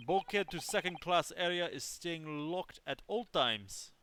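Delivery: proverb with Russian pride; Russian accent. Acted. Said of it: "sorry to literally anybody who actually has a Russian accent".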